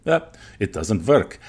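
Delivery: slow, mock German accent. Colleague quoting German drug makers' verdict, German accent. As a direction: put on a German accent for the quote. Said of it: "allowed to do a German accent".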